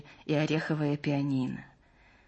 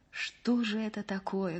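soft, lost wondering; quietly baffled murmur; bewildered; 3 semitones higher, 6 dB quieter than usual. A narrator's reading. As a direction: ask it softly, at a loss. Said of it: "говорила растерянно Маша".

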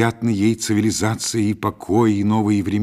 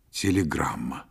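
low, hushed near-whisper; 5 semitones lower, 6 dB quieter than usual. A narrator's reading. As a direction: say it softly, almost whispering. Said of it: "сказал шёпотом". (тихо, почти шёпотом)

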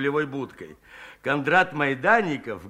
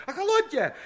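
even higher but softer, pleading; softer high plea; 13 semitones higher than usual. Acted. Frightened Shchukar begs the women again, high and softer. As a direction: repeat охолоньте high and quieter. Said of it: "упорно выкрикивал".

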